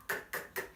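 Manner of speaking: breathy voiceless K clicks, no voice. Shown as a demonstration. Teacher demos voiceless K to students. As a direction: spit out K with air only. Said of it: "Não tem voz aí".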